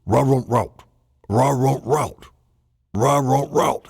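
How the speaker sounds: playful woof sounds; dog barking. Pretend. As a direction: bark it out like a dog talking. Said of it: "the dog is going".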